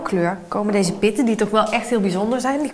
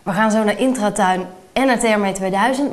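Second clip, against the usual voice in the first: ordinary talk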